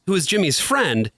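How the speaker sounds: ordinary talk, nothing marked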